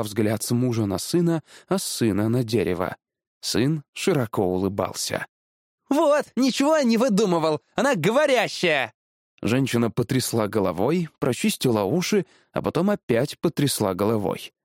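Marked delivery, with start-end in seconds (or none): 5.9-8.9 put-on high, excited boyish exclamation; triumphant boy. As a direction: crow that you were right. Boy proved right before parents. (ликующий мальчишеский возглас)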